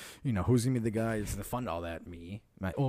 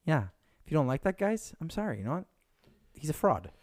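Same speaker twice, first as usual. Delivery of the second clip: ordinary talk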